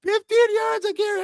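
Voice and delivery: high squeaky disbelief. Real, not acted. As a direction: shoot way up in disbelief. Repeats it in disbelief.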